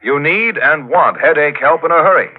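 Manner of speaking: ordinary delivery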